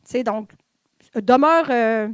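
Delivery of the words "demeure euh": put-on louder, firm order to a child to stay still; parent giving orders. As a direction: tell a fidgety kid to hold still. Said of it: "quelque chose du genre". (ordre ferme à un enfant, plus fort)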